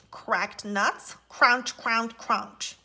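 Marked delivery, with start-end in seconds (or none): 1.3-2.8 crunching. Acted. Squirrel cracking nuts.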